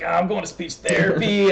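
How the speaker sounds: high-pitched boastful kid announcement, played for a joke; hammed-up excited brag, pitched well up; mock proud excitement. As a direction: jokingly show off like a thrilled schoolkid. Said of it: "I thought it was, like, cool".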